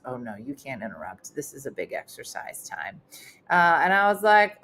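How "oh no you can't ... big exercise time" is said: much lower, softer staff lady; a stern staffer says no, low and quiet; lower, softer lady's voice. Retold or imitated. Lady forbidding the piano.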